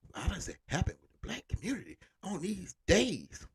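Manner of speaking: mocking a critic's whiny question; high, sneering imitation of a critic.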